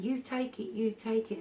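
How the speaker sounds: imitating an elderly Scottish lady, lightly done; old Scottish lady. Old Scottish lady urging the brother, light Scottish accent. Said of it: "I can't do a Scottish accent".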